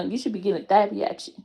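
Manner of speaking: ordinary delivery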